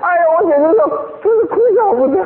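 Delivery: real amused exasperation; exasperated amusement. Real, not acted. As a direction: throw up your hands, amused and helpless.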